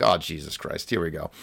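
low, soft exasperated aside; sinking, under-the-breath resignation; retold resigned dismay. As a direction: mutter it as if trouble is coming. Acts out his dismay, retold.

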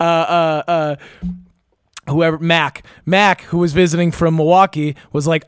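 normal talking voice

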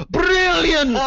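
high, loud gushing cry of praise; mock rapturous praise. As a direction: squeal it out like a starstruck fan.

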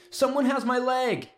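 very high, strained yell; high held yell of panic, no added volume; desperate cry for help. A narrator's reading. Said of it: "yelled as loud as possible".

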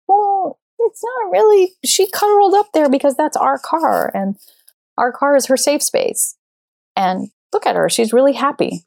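high, indignant pleading voice; defensive reply. Retold or imitated. Replying to the woman about her dog, high and defensive.

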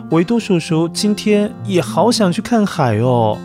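plain reading voice

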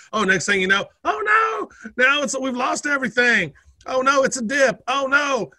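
high hammed-up alarmed cries; mock panic. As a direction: play the panicked investor. Mock panic at crypto dip.

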